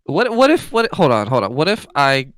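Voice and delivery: loud, high, stumbling burst, then settling; urgent interruption. Acted. Galen breaking in before paying for fish, excited. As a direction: cut in excitedly with a sudden idea.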